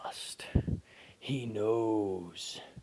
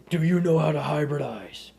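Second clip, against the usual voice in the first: higher, louder exasperated repeat; louder exasperated question; 5 semitones higher, 11 dB louder than usual. Acted. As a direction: shout the question back. Repeated loudly after "What?.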